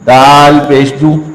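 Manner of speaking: "दाल पेश दू" drawn out slowly in a chant; slow spelled recitation. Shown as a demonstration. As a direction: spell each sound slowly and clearly. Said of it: "ऐसे देखिए". (धीमी तिलावती हिज्जे)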